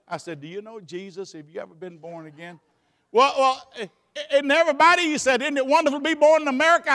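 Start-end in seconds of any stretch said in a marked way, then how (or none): 0.4-2.6 quiet earnest question. Retold or imitated. Preacher quietly asking the doctor about Jesus. 3.1-6.9 high, fast stammering doctor; flustered doctor. Retold or imitated. Doctor dodging the question, high and fast.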